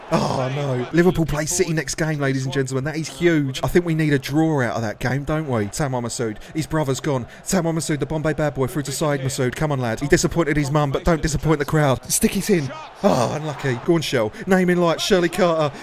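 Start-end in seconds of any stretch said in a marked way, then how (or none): none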